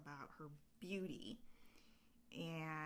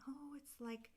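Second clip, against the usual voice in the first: admiring fairy; 8 semitones higher, slower than usual. Retold or imitated. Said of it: "they're saying".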